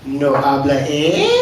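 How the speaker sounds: low start, Canadian "eh" tacked on; hammed-up Canadian pretending to speak Spanish; Canadian-flavoured Spanish. Canadian answer faking Spanish.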